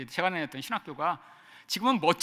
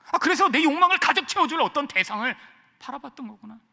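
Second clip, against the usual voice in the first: usual speaking voice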